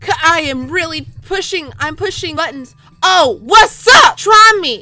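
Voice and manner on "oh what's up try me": loud, drawn-out challenge; shouted taunt. Real, not acted. Gamer taunts the opponent, shouted taunt.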